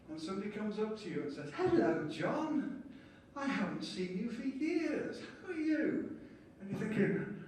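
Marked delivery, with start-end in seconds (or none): 1.6-6.2 gushing party greeting. Retold or imitated. Overfriendly stranger at a party.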